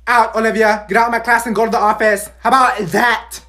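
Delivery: loud teacher yelling; angry yelling. Acted. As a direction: throw her out, shouting. Teacher throws student out.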